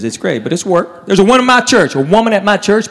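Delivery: ordinary talk, nothing marked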